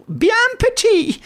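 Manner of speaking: high-pitched squeaky companion; thin high character voice; Wilson, injured sidekick. Acted. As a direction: pipe up in a high, put-on voice.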